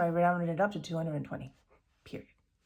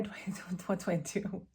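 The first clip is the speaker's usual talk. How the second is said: embarrassed laugh; 5 dB quieter than usual. Real, not acted. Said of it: "a embarrassed laugh".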